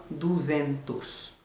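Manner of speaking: "duzentos" said slowly and clearly, "doo-ZEN-toos"; slow Portuguese "duzentos". Shown as a demonstration. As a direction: spell out "duzentos" syllable by syllable. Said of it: "Kòman ou di de san?". (pwononse "duzentos" dousman, klè)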